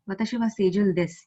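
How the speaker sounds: "Watashi wa seijuu desu" quick and level to the end; flat intonation. Shown as a demonstration. Teacher demonstrating an assertive sentence, flat intonation. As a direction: keep desu level, with no rise. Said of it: "It is flat".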